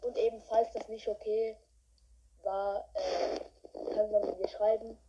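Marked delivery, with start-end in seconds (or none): none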